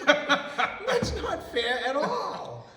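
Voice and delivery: high, loud protest through laughter; laughing protest. Retold or imitated. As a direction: draw it out indignantly, laughing. Retold protest while laughing.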